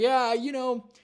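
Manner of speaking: high, loud parody of breezy self-excuse; mocking imitation. Mocking paraphrase of the video maker.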